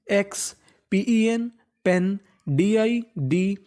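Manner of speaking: letters and syllables drawn out one by one; teacher breaking a word into parts; slow spelling out. Shown as a demonstration. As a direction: spell each chunk very slowly for the class. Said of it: "टीयूआरई से क्या पढ़ना है?".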